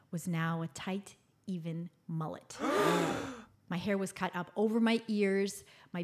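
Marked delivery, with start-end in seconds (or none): 2.6-3.4 sharp gasp of dismay; horrified gasp. Retold or imitated. Girl sees her new mullet in the mirror, horrified gasp. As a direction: suck in breath, aghast.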